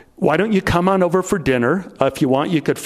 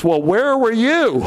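put-on impatient girlfriend; 6 semitones higher, slower than usual. Girlfriend Beth asks [name] where he was: higher, impatient. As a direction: needle him for being late, higher. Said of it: "My girlfriend Beth is waiting there and says".

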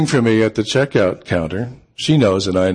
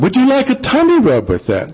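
very high, playful imitation of a woman; sing-song woman's voice. Woman not backing down from stranger, high sing-out. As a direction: tease back in a high put-on lilt. Voicing the woman who sings out.

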